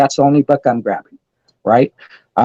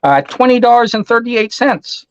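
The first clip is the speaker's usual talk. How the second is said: imitating the store clerk; 8 semitones higher than usual. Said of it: "And she goes".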